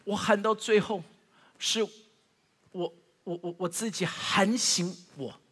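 ordinary talk

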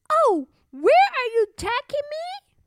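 the harp cries out in alarm; the magic harp waking and calling out, high. Acted. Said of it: "the harp called out loudly".

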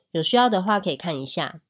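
ordinary talk

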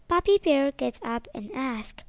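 plain reading voice

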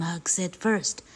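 ordinary delivery

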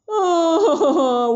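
high loud wail; wailing cry. Acted. Demon king bursting into tears, loud wail. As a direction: let out a child's wail. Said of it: "哇的哭了起来了".

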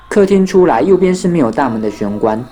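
ordinary delivery, nothing marked

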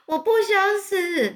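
high, slow anguished shriek; a drawn-out cry of despair; desperate scream. A narrator's reading. Wilbur screams, then throws himself down, slow and high. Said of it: "韦伯就尖叫说".

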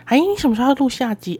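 eager coworker pestering her; nagging senior. Retold or imitated. 学姐一直追问什么时候录下一集，催促地问. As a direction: ask it like a coworker who keeps nagging. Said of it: "而且她还一直说".

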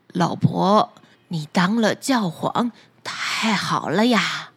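put-on low, slow, half-crying old man; an old fisherman choked up in awe; fisherman, tearful. Fisherman in awe of his wife the pope, low and teary.

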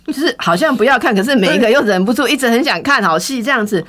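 normal talking voice

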